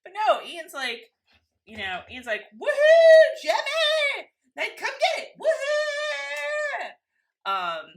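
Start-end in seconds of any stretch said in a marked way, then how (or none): 2.6-6.9 put-on very high, whooping kid at play; excited calling out. Playing Ian calling to Jemmy, high excited whoops. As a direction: whoop and call out in a high squeal.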